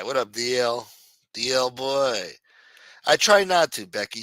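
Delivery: ordinary talk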